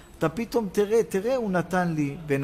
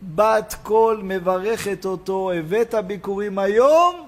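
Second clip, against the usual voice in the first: raised, ringing sermon delivery; impassioned preaching; 3 semitones higher, 5 dB louder than usual. Acted. Preacher promising blessing to the thankful, impassioned preaching. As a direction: proclaim the promise with long, loud phrase endings.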